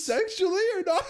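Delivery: high, loud, giggling through the words; laughing question. Real, not acted. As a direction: ask it while cracking up. Teasing joke question, laughing.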